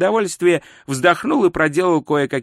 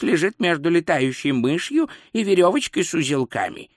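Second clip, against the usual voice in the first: meandering expert lecture in character; pedantic astrologer; 2 semitones higher than usual. Acted. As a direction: explain it at leisure like a fussy scholar. Astrologer's figure voice.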